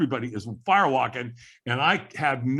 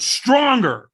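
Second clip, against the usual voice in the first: a coach barking for more effort; loud demand; 9 semitones higher, 8 dB louder than usual. Retold or imitated. Firewalk trainer pushing him to yell, loud and high.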